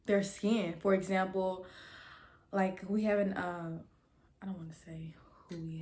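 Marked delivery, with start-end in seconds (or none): 4.4-5.8 hushed secretive aside; whisper. Real, not acted. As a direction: drop to a whisper, keeping it secret. Secretive aside about a classmate.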